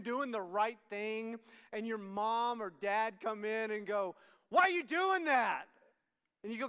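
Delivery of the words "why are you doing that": high, loud mock-parent; blaring mom or dad; accusing parent.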